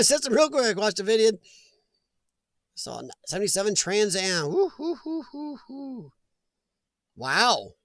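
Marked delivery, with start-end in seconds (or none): 4.5-6.1 excited hooting. Real, not acted.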